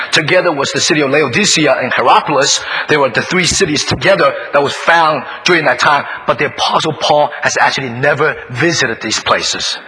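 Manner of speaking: ordinary talk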